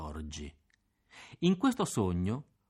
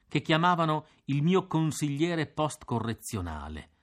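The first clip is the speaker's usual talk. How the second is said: ordinary delivery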